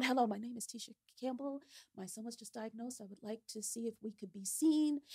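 hurried, hushed phone call; fast, quiet phone voice. Retold or imitated. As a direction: rush through it politely. Polite phone call in panic.

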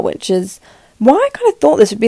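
normal talking voice

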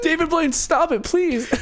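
high, exasperated begging; mock pleading. Bystander begging David Blaine to stop, high mock plea.

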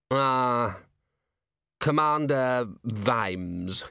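very slow, drawn-out groggy lord; murmuring patient. A narrator's reading. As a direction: come round slowly from a faint. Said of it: "he murmured".

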